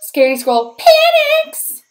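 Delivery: high, loud panicked read. Acted. Narrator voicing the squirrel's sudden panic. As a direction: sound the alarm.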